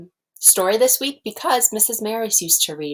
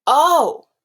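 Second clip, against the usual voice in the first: surprised; 9 semitones higher, 6 dB louder than usual. Acted. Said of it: "said Toad".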